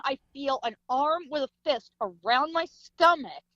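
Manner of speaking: ordinary delivery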